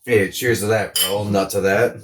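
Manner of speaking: normal talking voice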